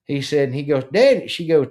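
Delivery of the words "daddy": lightly imitated daughter calling Daddy; higher, louder retold call for Dad; child calling out. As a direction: call out like an alarmed kid, lightly.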